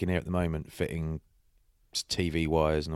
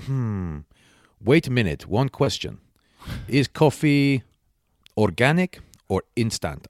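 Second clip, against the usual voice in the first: imitating a Romanian builder questioning his host; louder put-on Romanian accent, each phrase set apart; 4 semitones higher, 5 dB louder, slower than usual. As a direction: quiz them in a mock Romanian accent. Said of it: "is that a Romanian accent".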